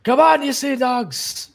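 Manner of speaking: high, loud rallying yell; full-throated high battle cry to sailors; sea captain's shout. Acted. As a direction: holler at the crew like a pirate captain. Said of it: "and he goes".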